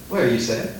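ordinary delivery

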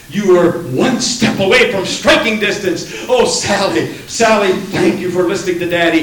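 loud, high, laughing relief and gratitude; overjoyed your child is safe; relieved father. Acted. Relieved father thanks daughter.

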